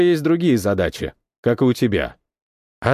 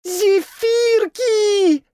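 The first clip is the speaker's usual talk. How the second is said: very high, slow delighted squeak; squeaky delight; much slower than usual. A narrator's reading. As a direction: squeal with joy over the treats. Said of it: "запищала Азель".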